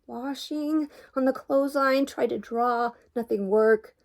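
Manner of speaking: hammed-up wailing fragments; tearful confession. A narrator's reading. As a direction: blurt it out in upset bursts. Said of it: "the whole story came tumbling out".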